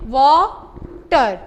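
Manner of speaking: वाटर split into two slow syllables. Shown as a demonstration. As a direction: pull वाटर apart, syllable by syllable. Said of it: "इसको separate करें".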